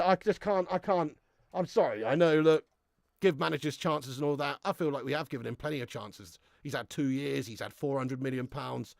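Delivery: ordinary delivery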